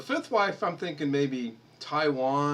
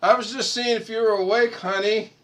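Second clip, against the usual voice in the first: loud, higher, calling across the room; husband calling to his wife, loud and higher; calling sweetly; 10 semitones higher, 9 dB louder than usual. Real, not acted. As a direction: call out innocently to her.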